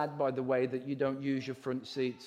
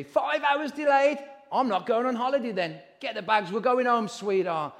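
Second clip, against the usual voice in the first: higher, indignant put-upon traveller; impatient holidaymaker; 8 semitones higher, 5 dB louder than usual. Pretend. Traveller told the plane is delayed, indignant and fed up. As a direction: huff about the delay and pack up.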